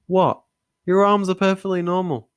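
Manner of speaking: high, loud disbelief; high loud incredulous doctor; astonished. Acted. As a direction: react as if he's mad.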